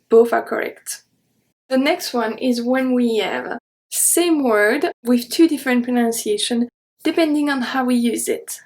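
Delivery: normal talking voice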